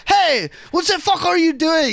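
put-on high-pitched cop with wobbly French accent; mock French accent. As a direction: challenge them in a bad French accent. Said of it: "He was French for some reason".

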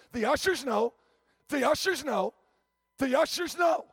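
repeats it, climbing to a near shout; "The ushers know", each time pushing harder; building insistence. Retold or imitated.

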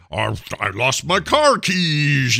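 slow, high mock Scottish drawl; Sean Connery. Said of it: "he was going full Connery".

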